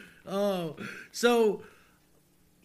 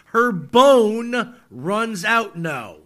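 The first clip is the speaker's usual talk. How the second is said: dramatic reading; 7 dB louder, much slower than usual. Shown as a demonstration.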